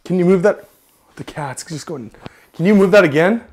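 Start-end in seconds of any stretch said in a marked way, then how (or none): 0.0-0.7 raised, louder questioning voice; louder, projected request; calling out to spirit. Real, not acted. 2.5-3.4 loud, higher questioning call; raised voice, lifting at the end; calling out to spirit. Real, not acted.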